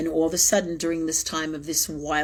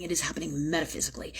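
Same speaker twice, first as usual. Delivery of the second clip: whispered aside; 3 semitones lower, 7 dB quieter, much faster than usual. Real, not acted. Aside in story.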